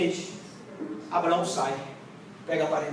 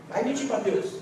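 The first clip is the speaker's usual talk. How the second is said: normal talking voice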